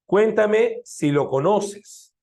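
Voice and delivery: slow, high, every word drawn out; indirect question example. Shown as a demonstration. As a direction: spell out the example slowly for the class. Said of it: "una indirecta total sería con el sí, así". (ejemplo lento y marcado, bien agudo)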